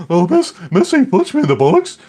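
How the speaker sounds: high, loud whiny schoolkid voice; a kid telling tales to the teacher; tattling child. Retold or imitated. A dad playing a child complaining to the teacher. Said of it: "I'm telling the teacher, like".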